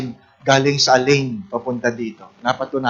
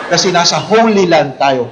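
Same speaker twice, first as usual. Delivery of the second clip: usual speaking voice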